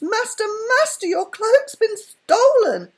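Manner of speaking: high, loud shriek of alarm; scream. A narrator's reading. Housekeeper screaming to the wizard that his cloak is stolen. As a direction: scream it out at the top of the voice. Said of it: "Miggy gave a scream".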